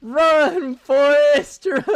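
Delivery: high, loud call breaking into laughter; hammed-up movie shout. Streamer mocking the game's accent with a movie line, hammed-up shout. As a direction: holler it out, cracking up.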